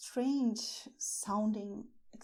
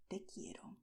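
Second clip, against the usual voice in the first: soft unvoiced whisper, tender; romantic whisper; 2 semitones lower, 11 dB quieter than usual. Shown as a demonstration. Teacher to learners, whispering "Te quiero" romantically. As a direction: breathe it like a secret to a lover.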